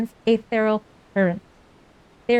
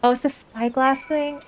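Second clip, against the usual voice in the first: ordinary talk, nothing marked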